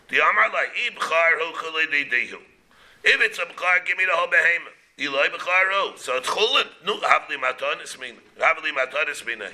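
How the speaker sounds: ordinary talk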